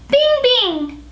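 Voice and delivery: put-on very high, childlike "Bing, bing"; childlike head voice. Shown as a demonstration. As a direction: sing "Bing" way up like a baby. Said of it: "the top note should be very childlike".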